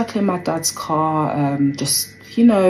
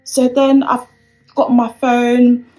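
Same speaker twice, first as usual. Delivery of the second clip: flustered; 9 semitones higher, 7 dB louder than usual. Real, not acted. Still shaken after the arrest.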